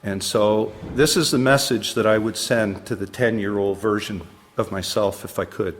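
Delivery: ordinary delivery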